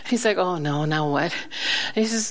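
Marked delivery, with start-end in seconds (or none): 0.4-1.3 low, mildly dismayed reaction; retold dread. Pastor retelling the police chief's reaction, mild low dismay. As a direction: mildly imitate someone expecting more trouble.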